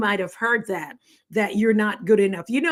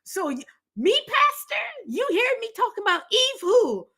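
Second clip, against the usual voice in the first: churchgoer; 10 semitones higher than usual. Retold or imitated. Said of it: "They're gonna be like".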